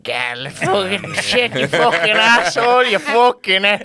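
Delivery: high-pitched mock folk singer swearing away; lone Irish folk singer. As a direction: caricature one voice left from a rowdy group. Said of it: "if you take away three of those voices".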